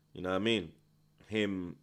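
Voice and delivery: usual speaking voice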